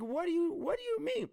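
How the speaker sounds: high-pitched mock bafflement; hammed-up indignant confusion; retold exasperated disbelief. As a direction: sputter it, incredulous, voice shooting up. Retold baffled reply to mom.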